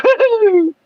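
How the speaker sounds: a very high, thrilled whoop; retold woo-hoo, high and thrilled; gleeful whoop. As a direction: whoop like you're on a ride. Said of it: "I'm like".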